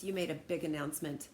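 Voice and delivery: ordinary delivery, nothing marked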